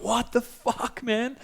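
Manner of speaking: high, drawn-out, softened disbelief; incredulous. Retold or imitated. Wetting himself at his friend's story, high-pitched and incredulous. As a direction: squeal it slowly in retold amazement.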